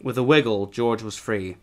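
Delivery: plain reading voice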